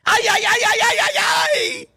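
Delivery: very high, panicky robot yelps, slowing at the end; shrill panicking sidekick robot cry; Alpha impression. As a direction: squeal it like a frantic little robot. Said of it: "sounded half Alpha and half Mexican".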